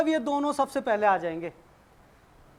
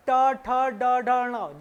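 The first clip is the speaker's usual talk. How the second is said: ट ठ ड ढ ण spoken slowly, each letter apart, tongue curled back; slow letter demo; much slower than usual. Shown as a demonstration. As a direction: sound out ट ठ ड ढ ण one by one. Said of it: "जीभ मुड़ती है".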